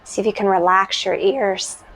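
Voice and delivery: calm, unhurried meditation voice with long pauses; guided meditation. Real, not acted.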